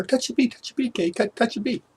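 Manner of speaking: put-on high, teasing chant of a bratty older girl; singsong taunt. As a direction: egg her on, mocking and high. Girls egging on his sister.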